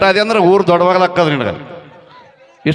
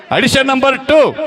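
high, drawn-out announcement; announcer call; 9 semitones higher, slower than usual. Acted. Director summoning the next auditioner, announcer call.

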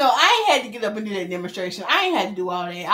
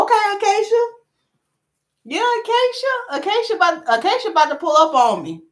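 excited calling out; 11 semitones higher, 6 dB louder than usual. Real, not acted.

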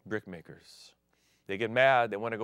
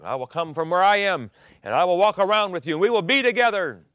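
higher, louder, faster promise; rising fervor; 8 semitones higher, 10 dB louder than usual. Acted. Preacher voicing God eager to walk with Adam. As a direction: swell into God's joyful promise.

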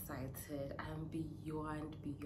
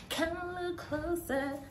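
playful tease; 12 semitones higher, 5 dB louder than usual. Real, not acted. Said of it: "should I come closer".

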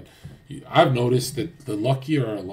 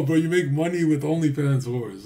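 ordinary talk, nothing marked

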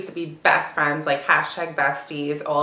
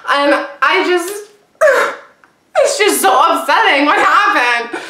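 high, loud, tearful outburst with a sigh; tearful upset; 9 semitones higher, 6 dB louder than usual. Real, not acted. Venting about the hair-pulling fight, tearful and overwhelmed. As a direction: break into a cry while recounting it.

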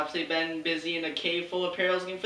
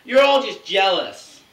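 loud defiant; 11 dB louder, slower than usual. Acted. Defending himself, loud and slow.

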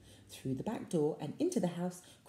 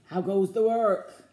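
loud farmer's call; the farmer demanding news; 9 dB louder than usual. A narrator's reading. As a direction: bellow it from bed. Farmer's voice in retelling.

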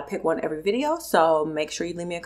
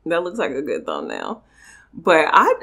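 usual speaking voice

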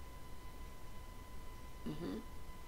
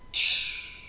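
a voiceless tongue click of contempt; dismissive tch; 3 dB quieter than usual. Retold or imitated. Brushing off a compliment, dismissive tongue click. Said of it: "you're like".

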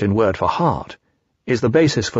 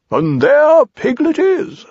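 high, loud, drawn-out owl; swooping storybook owl; Owl explaining; 5 dB louder, much slower than usual. Acted. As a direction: pronounce it slowly like a pleased owl.

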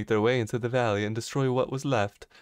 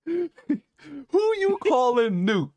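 mock offended; 4 dB louder, slower than usual. Mock offended retort to friends.

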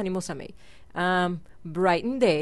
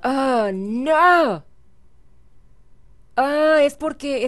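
dismayed; 4 semitones higher, 5 dB louder, much slower than usual. Real, not acted. Rejected proposal in game.

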